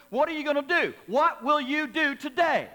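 loud, high, slowed pulpit cry; climactic shout. Acted. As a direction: hit the peak of the appeal. Preacher's peak appeal.

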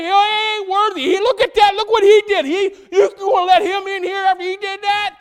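the devil shouting his accusation before the Father; devil accusing. Acted. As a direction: yell the devil's slander at the judge. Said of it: "He's trying to say".

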